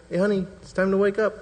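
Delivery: a father gently waking his daughter; gentle wake-up. Shown as a demonstration. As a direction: coax the child awake kindly. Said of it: "it's just gentle".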